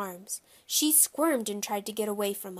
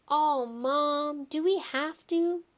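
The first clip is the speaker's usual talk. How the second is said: reluctant whine; 6 semitones higher, slower than usual. Acted. Boy whines at his mother.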